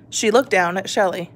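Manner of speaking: ordinary delivery, nothing marked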